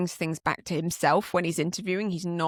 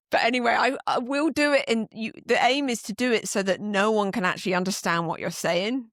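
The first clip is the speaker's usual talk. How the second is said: normal talking voice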